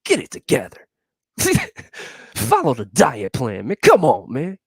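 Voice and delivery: self-coaching mock rant; mock pep talk. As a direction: bark it at yourself. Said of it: "I'm like".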